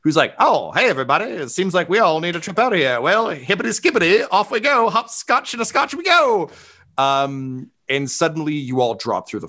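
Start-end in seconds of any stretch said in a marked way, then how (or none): 0.4-6.5 high, sing-song chirpy figure; Stonebender. Acted. Stonebender taking everybody on a trip out, high sing-song voice. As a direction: climb higher and quicker toward the send-off. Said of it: "to the Stonebender, who's like".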